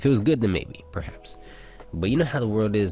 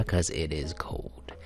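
whisper; 3 semitones lower, 5 dB quieter, slower than usual. Real, not acted. Says room is cold.

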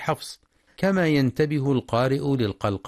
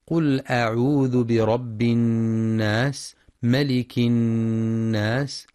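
very slow tajwid chant; Quran recitation; slower than usual. Shown as a demonstration. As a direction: draw the verse out slowly.

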